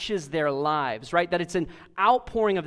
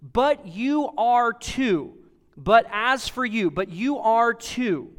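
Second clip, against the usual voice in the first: "But you are too" with each word weighted, high and slow; heavy, deliberate pulpit emphasis on "But you are too"; emphatic words; 4 semitones higher, 4 dB louder, slower than usual. Acted. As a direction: punch out "But as for you", louder on each beat. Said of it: "Paul opens with very emphatic words".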